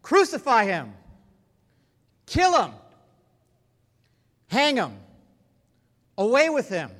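loud, high mob yells; crowd shouting. Acted. The crowd shouting back at Pilate, loud furious yells. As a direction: bellow each cry like an angry crowd. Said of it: "They shouted back".

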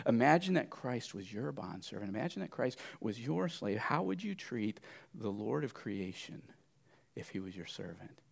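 ordinary talk, nothing marked

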